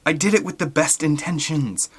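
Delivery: a light high plea; Billy insisting he meant well; pleading. Acted. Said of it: "Billy pleaded".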